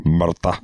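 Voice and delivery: Martha said as Scottish murder with a dropped r. Retold or imitated. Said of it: "they say Martha".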